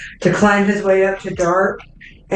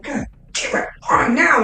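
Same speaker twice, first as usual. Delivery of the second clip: angry; 8 semitones higher than usual. Acted. Angry orc at broken horn.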